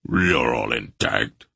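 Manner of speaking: low rumbling warrior voice. A narrator's reading. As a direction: rumble it from deep in the chest. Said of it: "Barak rumbled".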